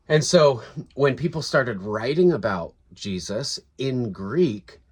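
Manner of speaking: normal talking voice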